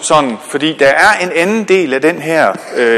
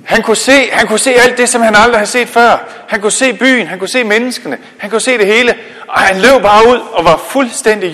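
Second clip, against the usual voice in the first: joyful; 7 semitones higher, 4 dB louder than usual. Acted. Joy at regained sight.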